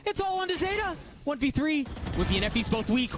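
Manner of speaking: rising, ecstatic high play-by-play; esports caster. Acted. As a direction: scream the clutch win like a caster. Clutch win play-by-play.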